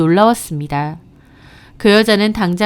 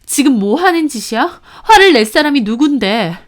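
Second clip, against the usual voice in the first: indignant; 5 semitones higher, 5 dB louder than usual. Acted. Wife fires back at husband.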